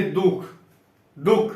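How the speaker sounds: दुख with weight on the first sound दु; दुख, leaning on दु before the closing breath; stress before visarga. Shown as a demonstration. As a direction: press on दु in दुख. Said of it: "इस पे बल पड़ रहा है".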